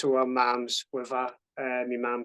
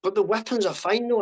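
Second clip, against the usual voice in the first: dad in disbelief; 7 semitones higher, 5 dB louder, faster than usual. Retold or imitated. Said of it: "He goes".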